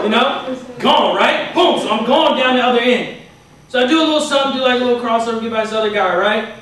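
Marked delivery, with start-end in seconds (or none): none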